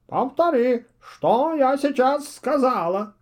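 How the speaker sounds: high, slow owl voice echoing dutifully; obedient parroting. A narrator's reading. Owl parrots the witch's order back to her. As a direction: innocently repeat her words back. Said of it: "послушно отозвался Филипп".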